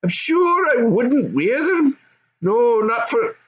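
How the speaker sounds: put-on high young goblin son. Goblin son answering his dad, high and eager.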